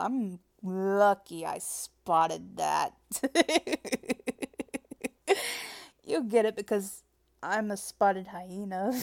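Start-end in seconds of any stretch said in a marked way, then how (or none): 3.0-6.0 high, louder rippling giggle; long run of giggles. Acted. Pleased with a pun, high rippling giggle.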